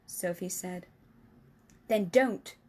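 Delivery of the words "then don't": louder curt reply; sharp retort. Acted. Giant silencing Sophie sharply while hunting. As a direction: cut her off. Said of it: "answered sharply".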